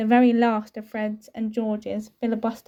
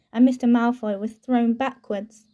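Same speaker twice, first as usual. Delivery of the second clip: ordinary delivery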